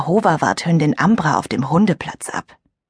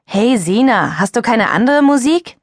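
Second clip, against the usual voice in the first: grumbling; 5 semitones higher than usual. Acted. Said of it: "maulte Sebastian".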